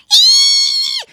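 very high, loud squeal; piercing squeal. A narrator's reading. Little sister in danger, squealing loudly. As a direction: let out a long frightened squeal. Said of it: "heard a squeal".

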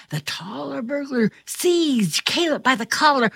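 usual speaking voice

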